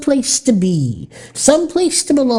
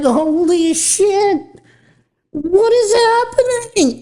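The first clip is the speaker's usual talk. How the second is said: slow, high, stunned disbelief; shocked disbelief; 8 semitones higher, slower than usual. Retold or imitated. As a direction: gape at the sight, slowly. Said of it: "And I was like".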